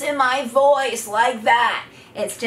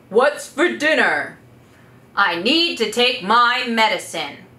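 strong authoritative voice; 4 dB louder than usual. Shown as a demonstration. Coach showing daily phrases in a strong authoritative voice. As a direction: say it with calm authority. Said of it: "strong, authoritative voice in a low pitch".

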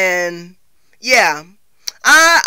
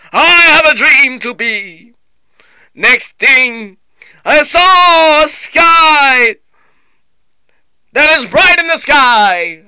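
booming oratory with long-held key words; ringing, stretched preacher cadence; Martin Luther King. Shown as a demonstration. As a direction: proclaim it like a speech to a crowd. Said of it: "do the sound of Doctor Martin Luther King".

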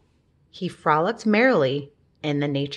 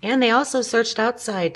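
ordinary delivery, nothing marked

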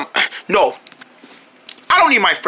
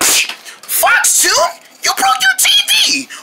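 shocked shout; much slower than usual. Acted. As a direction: shriek at the broken TV. Friend shocked TV smashed.

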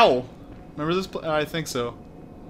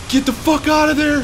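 panicking gamer; 10 semitones higher, 5 dB louder than usual. Real, not acted. Urging partner to flee danger.

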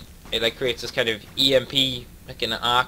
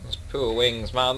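normal talking voice

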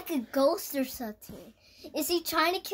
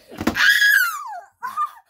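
very high, loud squeal; high-pitched outburst; shriek; 7 dB louder than usual. Pretend. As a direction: let out a piercing play shriek. Outburst in the play fight.

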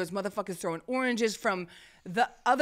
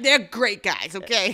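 raised-voice mock defence; loud defensive insistence; 8 dB louder than usual. Standing up for the trees to the buddy, loud defensiveness. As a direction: insist loudly, ending on a challenge.

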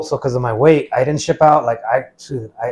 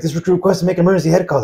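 lightly voiced recruit, higher and quick; retold urgent plea, hurried and higher; imitated urgent recruit; 6 semitones higher, faster than usual. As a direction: rattle off a mock formal request in a raised pitch. Quoting desperate recruit, legs shaking.

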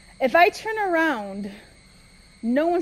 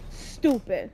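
ordinary talk

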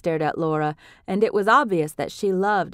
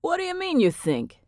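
confused; 10 semitones higher than usual. A narrator's reading.

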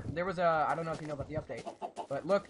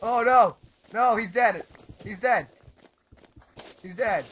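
loud, raised wail of alarm; dismayed cry; 5 semitones higher, 12 dB louder, much slower than usual. Real, not acted. Player announces teammate's death, loud dismay. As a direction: cry out that all is lost.

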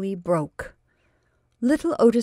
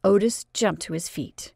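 ordinary delivery, nothing marked

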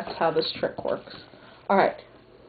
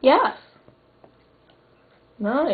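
excited; 5 semitones higher, 3 dB louder than usual. Real, not acted. Trick worked.

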